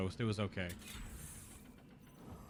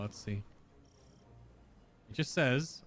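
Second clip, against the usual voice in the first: ordinary delivery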